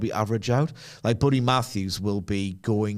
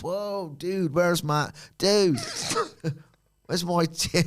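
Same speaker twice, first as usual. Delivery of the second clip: mock stoner dude; 6 semitones higher, slower than usual.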